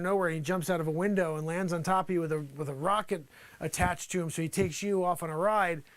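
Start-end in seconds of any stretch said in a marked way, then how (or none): none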